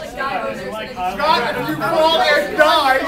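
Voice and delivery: high, slow, loud mock scream; scream on request. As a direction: belt it out like a scream. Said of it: "Do a scream".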